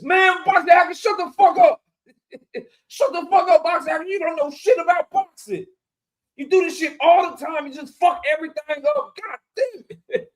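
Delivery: mock tirade, high-pitched and exasperated; D one. Imitating a streamer cutting off a panel guest, hammed-up angry rant. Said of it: "D one be mad at Boxer Hacker".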